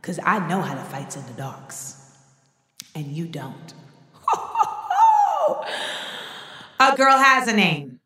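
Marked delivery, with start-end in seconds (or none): 0.0-3.7 softer, lower gloating whisper-like voice; a lowered, menacing gloat; smug quiet taunt. Retold or imitated. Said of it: "it's like". 4.3-6.3 a high mock villain laugh; a high triumphant cackle; evil cackle. Villainous gloat after taunt.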